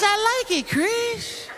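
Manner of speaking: dreamy Michael defending his taste. Retold or imitated.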